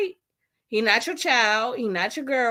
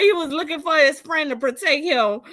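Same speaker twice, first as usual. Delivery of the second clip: normal talking voice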